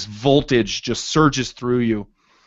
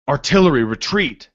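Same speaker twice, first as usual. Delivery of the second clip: called out louder, pitched up; shouted order; 4 semitones higher, 6 dB louder than usual. Acted. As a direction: bark the retreat order across the battlefield. Soldiers calling orders in battle.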